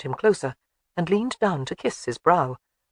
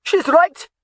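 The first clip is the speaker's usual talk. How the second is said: excited shout, much higher and louder; 12 semitones higher, 7 dB louder than usual. A narrator's reading. Young soldier shouts from the target, excited shout. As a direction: yell it back up the hill. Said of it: "he shouted".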